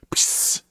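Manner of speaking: hissed psst to get attention; quick sharp psst. Pretend. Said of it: "stage whisper".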